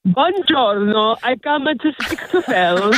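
slow, drawn-out mock Italian accent; playful Italian caller accent, unhurried. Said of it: "you're bringing me some Italiano".

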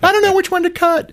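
high, fast squeaky panic voice; high, fast, hammed-up fright; mock panic. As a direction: squeal like a panicked bomb defuser. Mock panicked bomb defuser.

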